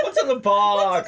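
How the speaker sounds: high, loud, strained cry of dread; a hammed-up desperate shout; anguished movie shout. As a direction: yell it like a man who fears the answer. Movie quote shouted, repeated.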